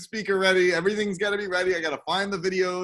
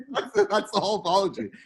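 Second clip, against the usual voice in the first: laughing; 2 semitones higher, 4 dB louder than usual. Real, not acted. Laughing at a short apology.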